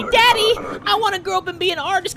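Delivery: high, fast mock little kid; high fast kid voice asking daddy; child voice. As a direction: squeak it like a pleading child. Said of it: "He better not tell me".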